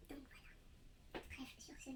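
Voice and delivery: very soft, slow whisper; whispered sign-off. Real, not acted. Vlogger whispering to wrap up her apology. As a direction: trail off into a whisper.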